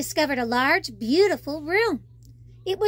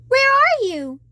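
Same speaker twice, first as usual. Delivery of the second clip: high, loud searching call; 10 semitones higher, 7 dB louder than usual. Acted. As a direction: shout to find him. Calling for missing friend.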